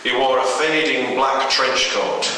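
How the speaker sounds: plain reading voice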